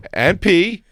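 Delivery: co-host imitation. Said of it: "her co-host goes".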